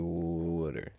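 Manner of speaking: low, soft "Water" as a slack WOOD-er; lazy South Jersey "Water", short OO; lazy local pronunciation. Shown as a demonstration. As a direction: let "Water" fall out lazily as WOOD-er. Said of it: "just a lazier way of speaking".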